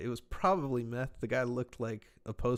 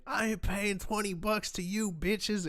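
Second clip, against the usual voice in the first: higher, slow, put-on voice of a rude stranger; mock drunk heckler; 8 semitones higher than usual. Stranger on the strip snubbing showgirls, slow mocking voice. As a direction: drag it out like a guy slurring at the girls.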